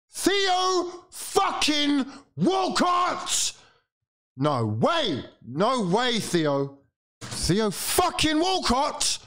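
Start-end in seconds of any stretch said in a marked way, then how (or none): 0.1-3.5 very high, slow, drawn-out shout; stretched, anguished roar; outraged yell. Real, not acted. Fan outraged at former player scoring. 7.2-9.2 very high, slow, drawn-out shout; stretched, anguished roar; outraged yell. Real, not acted. Fan outraged at former player scoring.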